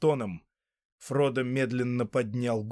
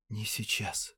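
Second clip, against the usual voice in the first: whispered; 2 semitones lower, 7 dB quieter, slower than usual. A narrator's reading. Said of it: "прошептал он".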